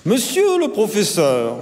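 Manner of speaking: high, drawn-out schoolboy call; attention-seeking pupil. A narrator's reading. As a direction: call out sweetly to get teacher's attention. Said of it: "commença-t-il pour attirer l'attention du prof".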